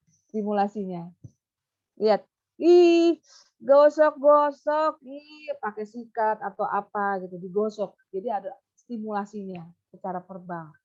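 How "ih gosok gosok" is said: high, loud and very slow; teacher stimulating a pupil verbally; animated stimulation. Shown as a demonstration. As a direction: exaggerate excitement for the child.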